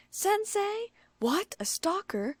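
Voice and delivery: high, ending in a whisper; horrified gasp. A narrator's reading. Richon horrified to hear about the stalker. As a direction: gasp the last words out in shock. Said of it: "Richon gasped, horrified".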